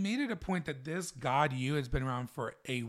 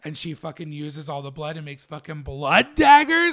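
excited; 13 dB louder than usual. Real, not acted. Excitedly retelling the show.

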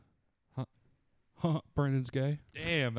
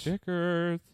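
higher, put-on nanny voice; Missus Doubtfire; 4 semitones higher than usual. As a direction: mimic the film nanny, drawn out. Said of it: "I was doing a Missus Doubtfire impression".

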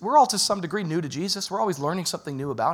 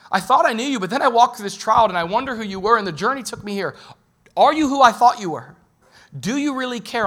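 louder, higher, desperate plea; pleading cry; 6 semitones higher, 6 dB louder than usual. Retold or imitated. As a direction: cry out to God in doubt. Believer's prayer voiced in a trial.

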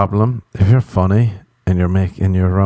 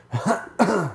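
small demonstration cough; mock symptom cough; little cough; 12 semitones higher, 6 dB quieter than usual. Shown as a demonstration. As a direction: give a light, quiet cough. Acts out an invented symptom.

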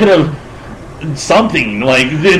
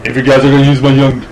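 imitating Arnie; 3 semitones lower than usual. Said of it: "almost hear him going".

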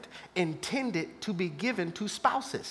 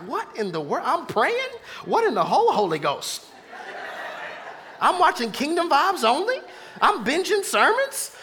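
mock bewildered; 7 semitones higher, 5 dB louder than usual. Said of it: "You wake up like".

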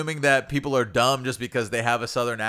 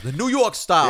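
higher New York accent on the words; 5 semitones higher, 4 dB louder than usual. Real, not acted. Host repeating a pizza style he orders, New York accent. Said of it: "you added an unnecessary accent to it".

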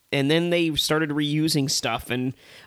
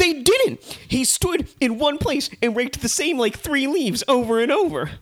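high-pitched indignant protest; worked-up comic outrage; exasperated outburst; 10 semitones higher than usual. Real, not acted. Heated comic objection to co-host.

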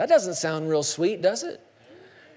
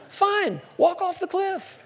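exasperated dismissal; 11 semitones higher, slower than usual. Acted. Exasperated dismissal of touchy listener.

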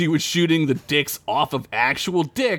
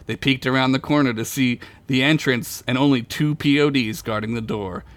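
ordinary talk

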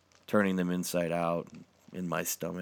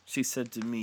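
usual speaking voice

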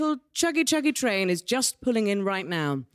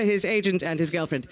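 ordinary delivery

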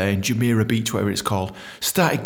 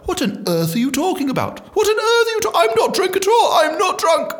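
put-on high, indignant posh English accent; hammed-up posh English outrage; poshest English. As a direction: protest in exaggerated posh English, climbing higher. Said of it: "switched into the poshest English I know".

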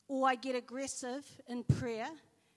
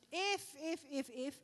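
hesitant stammer; 3 semitones higher, 4 dB quieter than usual. Retold or imitated. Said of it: "every second word was like".

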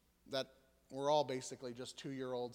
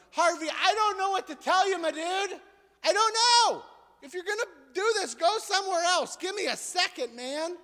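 put-on high, loud exasperated outburst at a toddler; cranky parent losing it with his two-year-old; fed up; 12 dB louder than usual. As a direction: snap at the little one, completely out of patience. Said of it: "I was just getting so fed up".

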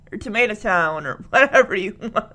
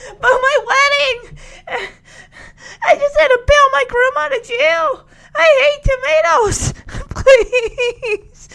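high, hammed-up tearful wail; mock crying; 13 semitones higher, 4 dB louder, slower than usual. Bride begging the bus driver for a ride, mock crying. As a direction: plead in a squeaky pretend sob. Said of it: "I love how she switches from crying".

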